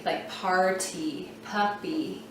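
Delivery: slow "party", "puppy" ending on long E; long E ending demo. Shown as a demonstration. Teacher modelling the E sound for kids. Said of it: "then it's gonna say E".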